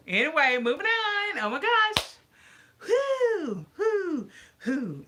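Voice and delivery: high, loud burst of exasperation, then laughs it off; high-pitched whoo to let off steam; exasperated release. Real, not acted. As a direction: shake the anger off with a big whoop. Shaking off her annoyance.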